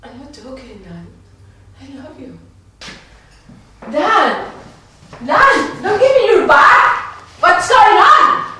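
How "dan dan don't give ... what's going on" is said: loud, high, furious shouting at him; angry shout. Acted. Woman yelling at Dan who turns away, furious shout. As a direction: yell at his turned back, demanding.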